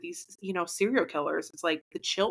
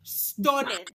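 higher, hammed-up British accent; exaggerated British delight; mock British accent; 4 semitones higher than usual. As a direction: relish it in a fake British accent. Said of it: "You can talk British".

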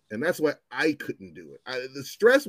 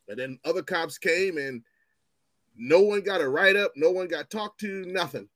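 ordinary talk, nothing marked

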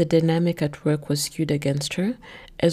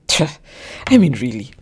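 puffing scorn; 3 semitones lower, 7 dB louder, faster than usual. A narrator's reading. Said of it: "he puffs as he continues".

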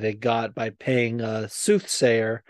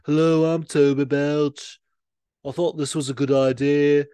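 put-on Yorkshire accent; 3 semitones higher, 5 dB louder than usual. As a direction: play Toby Belch in broad Yorkshire. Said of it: "kinda Yorkshire accent".

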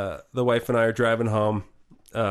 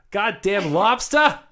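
high, slowly stretched Boston accent; 9 semitones higher, 4 dB louder, much slower than usual. Shown as a demonstration. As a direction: exaggerate a Boston accent as a practice phrase. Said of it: "like for Boston".

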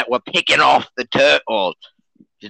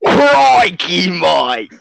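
mock Australian accent. Co-host tells a croc story in a mock Australian accent. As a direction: exclaim like a cartoon Aussie. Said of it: "he's Australian, if you can't tell".

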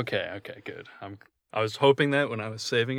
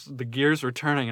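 ordinary talk, nothing marked

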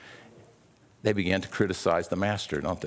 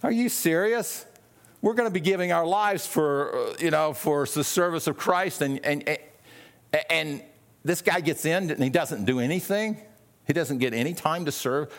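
higher, incredulous protest; mock indignant grumbling; 5 semitones higher than usual. Disciples protesting to Jesus, mock indignant and higher. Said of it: "Can you imagine them grumbling and saying".

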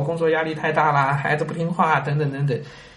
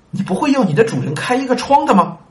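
fool's question; 8 semitones higher, 4 dB louder than usual. Acted. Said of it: "这傻子说的啊".

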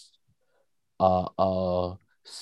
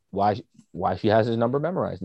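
ordinary delivery

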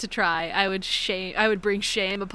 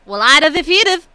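put-on loud, high-pitched Southern accent; Southern dialect; 6 semitones higher, 11 dB louder than usual. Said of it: "my Eastern Kentucky dialect".

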